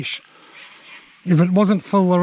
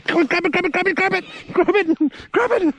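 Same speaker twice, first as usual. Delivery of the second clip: much higher, rapid urgent repeats; frantic urging; 11 semitones higher than usual. Real, not acted. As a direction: urge it on in a panic, pitched way up. Urging during a frantic moment.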